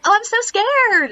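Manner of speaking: high, loud, slow hammed-up fright; mock terrified squeal. Girls squealing to the guide, hammed-up fear. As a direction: squeal it like a dramatic schoolgirl. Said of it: "they're like".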